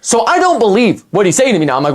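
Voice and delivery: ordinary talk, nothing marked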